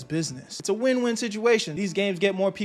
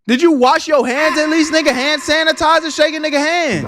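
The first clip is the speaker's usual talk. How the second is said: loud disgusted; 9 semitones higher, 10 dB louder than usual. Real, not acted. Grossed out by handshake.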